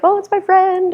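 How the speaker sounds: high, squeaky little greeting voice; delighted greeting. Retold or imitated. Catching a new friend's eye on silent retreat, high and delighted. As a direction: imitate a thrilled little hello to a friend.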